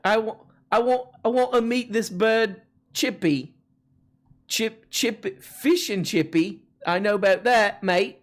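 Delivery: high, hammed-up British lad accent; bad British accent. Host mimicking a Brit meeting Chippy, bad British accent. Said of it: "like, a bad British accent".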